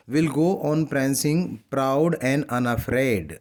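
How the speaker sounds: drawn-out reading of a poem line; slow poem recitation. Shown as a demonstration. Teacher reading a poem line to students, slow recitation. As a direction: read each word very slowly for the class.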